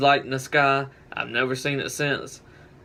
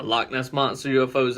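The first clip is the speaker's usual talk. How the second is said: usual speaking voice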